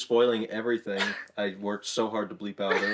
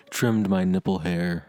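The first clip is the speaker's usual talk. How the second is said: hushed nature documentary. Pretend. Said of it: "my A S M R narrator voice".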